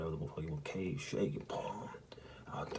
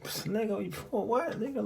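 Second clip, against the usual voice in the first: high, laughing disbelief; mocking laugh at the offer; laughing retort; 4 dB louder than usual. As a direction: scoff through a laugh, pitched way up. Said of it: "So I started laughing".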